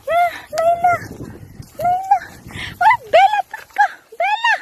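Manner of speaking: high-pitched pet talk. Real, not acted. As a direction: coo at the dogs by name. Calling the dogs by name.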